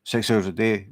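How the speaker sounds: normal talking voice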